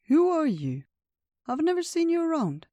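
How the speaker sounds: high, swooping questioning voice; inquisitive old bear. Acted. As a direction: quiz a newcomer in a high, loud lilt.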